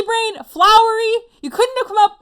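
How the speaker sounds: ordinary delivery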